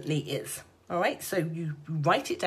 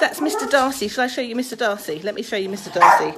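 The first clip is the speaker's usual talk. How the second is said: raised, quick and cheery; excited; 2 semitones higher, 10 dB louder, faster than usual. Real, not acted. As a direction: show off the dog, loud and fast. Showing off the barking dog.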